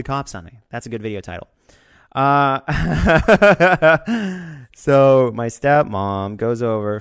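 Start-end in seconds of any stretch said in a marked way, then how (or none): 2.7-4.7 high, loud burst of laughter. Real, not acted. Laughing about the stepmom calling, loud laugh. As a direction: crack up hard. Laughing at his own story.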